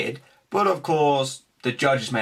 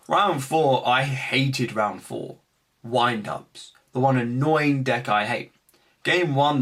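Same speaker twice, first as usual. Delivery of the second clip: ordinary talk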